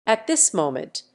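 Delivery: usual speaking voice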